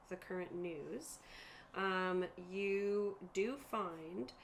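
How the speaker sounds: usual speaking voice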